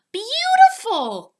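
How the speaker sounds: slow breath of wonder; awed beautiful. Acted. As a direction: awestruck exclaim. Poppleton awed by stars.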